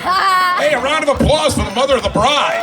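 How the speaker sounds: big loud announcement for the room; loud call to the crowd. Real, not acted. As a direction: shout it out over the room to get the guests clapping. Calling guests to applaud.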